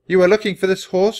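loud, high, stern challenge. A narrator's reading. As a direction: confront them like a stern prince. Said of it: "Prince Alexander said sternly".

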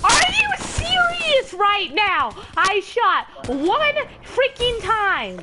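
very loud, high, drawn-out scream; full-blast furious shriek; screaming rage. Real, not acted. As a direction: scream every word slowly in outrage. Screaming in rage after dying.

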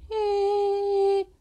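high, soft, held "eh"; imitated singing voice. Preacher imitating a woman's voice, high soft held note. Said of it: "si su voz es así".

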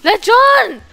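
high loud shout of a name; calling out loud. Real, not acted. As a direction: shout for him in alarm. Calling out to dead teammate.

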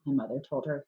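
usual speaking voice

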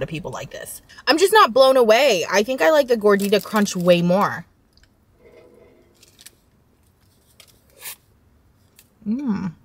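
muffled chewing between words; talking while chewing. Real, not acted. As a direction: mouth partly full. Seen 0: Holds bitten food; lips pursed, chewing motion while talking.

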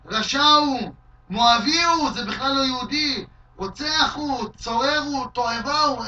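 loud, high string of curses; mock shout. Shimei cursing King David, a loud high string of insults. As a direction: spit out each insult at full voice. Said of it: "כך הוא צועק לדוד המלך".

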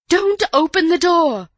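high, loud, panicked shout; panicked cry. A narrator's reading. Housekeeper as if her own door was threatened, panicked cry. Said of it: "cried Missus Stevens in a panic".

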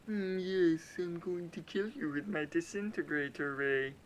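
hammed-up villain with a loud gloating start; cartoon villain. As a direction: gloat like a sci-fi bad guy. Villain voice threatening enemy.

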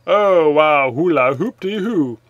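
normal talking voice

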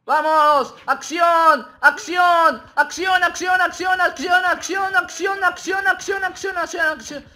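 high, loud battle cry, over and over; rally the squad at the top of your voice; excited shouting. Real, not acted. Gamer hyping his squad in a firefight, shouting.

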